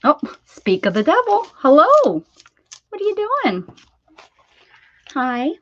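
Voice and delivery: high, loud delighted hello; light up as the dog walks in; excited high greeting. Real, not acted. Owner greeting the returning dog, loud and high with delight.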